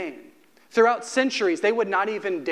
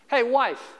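higher, casual, cool call across the room; impersonal call; 6 semitones higher than usual. Shown as a demonstration. Husband calling his wife by title, coolly impersonal. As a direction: call her like a stranger. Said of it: "If I were to start calling my wife".

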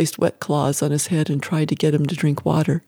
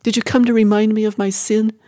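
higher-pitched, accusing outburst; anguished yell; 5 semitones higher, 4 dB louder than usual. A narrator's reading. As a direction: challenge them in anguish, a restrained yell. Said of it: "she yelled".

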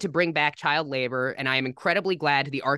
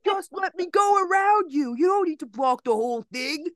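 yelling guy; 13 semitones higher than usual. Retold or imitated. Guy at crosswalk yelling at the helpers, mock shout. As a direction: ham up a pushy driver shouting at the crowd. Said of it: "a guy by the crosswalk yelling out".

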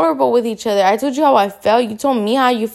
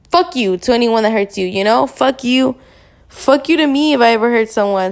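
ordinary talk, nothing marked

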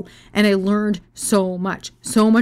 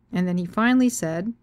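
normal talking voice

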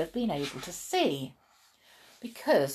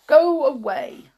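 loud, higher-pitched rude rebuff; 7 semitones higher, 11 dB louder than usual. A narrator's reading. Blind man refusing a stranger's company, loud and rude. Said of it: "said the blind man rudely".

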